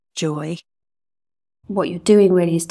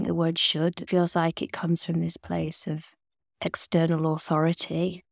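ordinary talk

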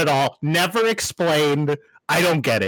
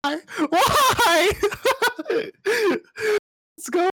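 high-pitched cry through real laughter; helpless high laughing squeal; laughing exclamation; much slower than usual. Real, not acted. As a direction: crack up while exclaiming, voice shooting way up. Cracking up at the story.

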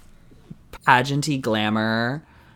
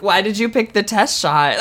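high, louder, hammed-up questioning; mock judge; 9 semitones higher, 6 dB louder than usual. Judge grilling a model about her photo, mock outrage. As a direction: demand an answer in a put-on high voice.

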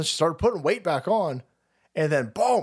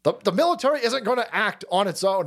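higher-pitched, fed-up retort; exasperated impression, pitched up; mock-irate general; 8 semitones higher than usual. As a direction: imitate a general cutting someone off, sick of it. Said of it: "General Flynn goes".

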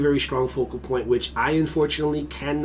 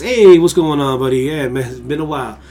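loud, cheery hey buddy; friendly greeting; 8 dB louder than usual. Retold or imitated. Greeting a buddy he hasn't seen, loud and cheery. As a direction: call out to an old friend.

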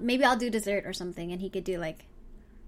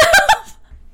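high burst of laughter; loud laugh; 12 dB louder than usual. Real, not acted. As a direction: crack up loudly. Laughs at own flub.

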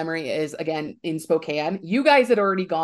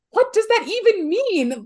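much higher, louder, incredulous squeak; flustered disbelief; 13 semitones higher, 5 dB louder than usual. Retold or imitated. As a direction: replay your own panicked confusion. Retelling her own flustered reply.

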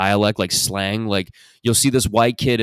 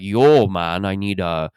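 stretched Toronto "yo" and "bro", swooping up; Toronto accent; 4 dB louder, slower than usual. Retold or imitated. Warehouse customer asking for machine parts, mock Toronto accent. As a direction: imitate a drawling Toronto customer. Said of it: "that was every customer".

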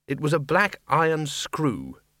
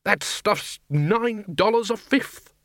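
higher, slow indignant roar; outraged roar; 8 semitones higher, slower than usual. Acted. Arch-Chancellor roaring about his floating brandy. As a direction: bellow the price in disbelief. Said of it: "he roared".

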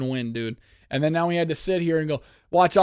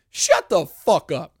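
louder, slow, fed-up retort; annoyed snap; 3 semitones higher, 6 dB louder, slower than usual. Real, not acted. Fed up with the broadcast, a slow annoyed retort. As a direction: tell the TV to shut up.

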